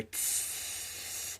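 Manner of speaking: long, drawn-out "tsss"; imitated fuse hiss. Narrator imitates the gauntlet about to blow, long hiss. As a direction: hiss softly through the teeth and hold it. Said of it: "then they hear".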